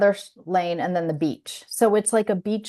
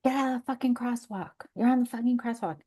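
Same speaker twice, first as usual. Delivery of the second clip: retold yelling; 7 semitones higher, 4 dB quieter, faster than usual. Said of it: "he starts yelling".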